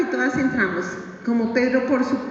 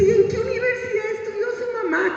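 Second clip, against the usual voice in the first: much higher, put-on sneering voice; mocking schoolgirl; 10 semitones higher than usual. Classmate mocking the girl's mother, high sneering voice. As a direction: ridicule her like a snobby classmate. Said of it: "Y entonces dice".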